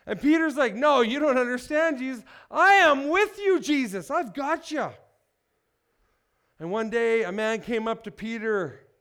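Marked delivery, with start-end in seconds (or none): 0.8-4.9 louder, emphatic vow of loyalty, peaking on I am; insistent protest. Acted. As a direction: Peter swearing he'll never leave Jesus, insistent and loud. Peter protesting loyalty to Jesus.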